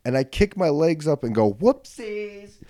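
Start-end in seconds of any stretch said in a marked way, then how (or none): 1.5-2.6 high-pitched, drawn-out whoopsies; retold yelp of oops, pitched way up; yelled whoopsies. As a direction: sing out a silly high cry. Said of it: "I don't know why I yelled whoopsies".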